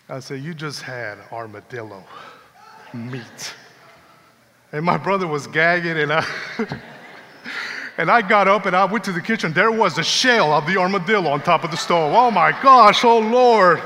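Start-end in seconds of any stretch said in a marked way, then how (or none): 0.4-3.5 softer, lower hushed reveal; hushed, teasing reveal to a brother of what he just ate. Retold or imitated. 12.1-13.8 louder, higher mock outcry; hammed-up horror at finding the armadillo shell; shocked outcry.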